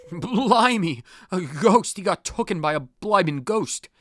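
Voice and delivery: put-on high, excited cockney kid; cockney lad gasping at a ghost; cockney accent. Boy tells about the ghost, excited cockney accent. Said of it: "Your accents".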